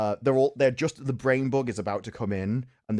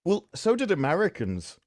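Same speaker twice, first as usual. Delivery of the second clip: normal talking voice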